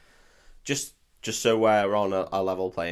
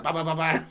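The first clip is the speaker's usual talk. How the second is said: higher-pitched mock gunshots; imitated gunfire; 5 semitones higher than usual. Listener imagining himself shooting everyone, playful gunfire noises. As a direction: rattle off silly shooting noises.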